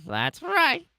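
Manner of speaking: squeaky pet voice, high up; high cat voice. Pretend. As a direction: speak it as a cute cat would.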